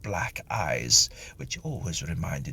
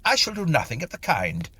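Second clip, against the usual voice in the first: high, louder indignant refusal; 10 semitones higher, 3 dB louder than usual. Acted. Mister Tilly refusing the medium, indignant and high. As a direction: refuse her with offended dignity. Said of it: "said Mister Tilly indignantly".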